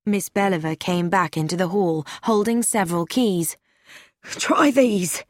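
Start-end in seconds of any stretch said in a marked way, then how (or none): 4.2-5.2 slightly breathless, raised pitch. A narrator's reading. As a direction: say it hurriedly, short of breath. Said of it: "she said breathlessly".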